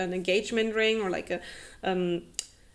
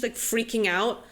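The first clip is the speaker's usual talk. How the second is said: normal talking voice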